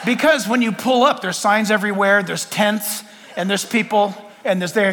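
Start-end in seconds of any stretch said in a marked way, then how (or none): none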